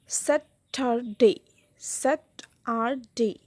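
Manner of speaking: Saturday in slow separate syllables, SAT weighted; stress on first syllable. Shown as a demonstration. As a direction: break up Saturday, leaning on the opening SAT. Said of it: "স্যাটে না আমরা বেশি".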